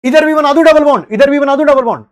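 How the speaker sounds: loud, high-pitched pestering chant; mock student demands. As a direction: imitate an insistent student calling out. Teacher voices students' repeated demands. (छात्र की नकल में ऊँची, ज़ोरदार ज़िद)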